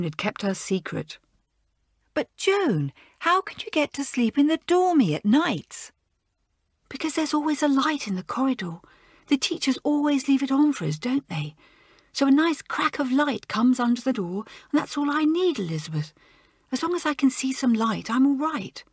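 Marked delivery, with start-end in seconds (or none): 2.1-5.9 puzzled schoolgirl. Acted. Elizabeth voiced, puzzled. 6.9-18.8 nervous schoolgirl. Acted. Joan explains, higher voice.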